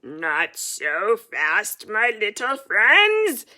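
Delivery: slow, loud menacing villain voice; Odious Mole snarling at the heroes; villain's snarl. Acted. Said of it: "a voice snarls".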